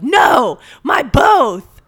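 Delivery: loud, high correcting yell; patient correcting the nurse, loud insistence; insistent yell. Retold or imitated. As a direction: snap the correction back. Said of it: "she goes".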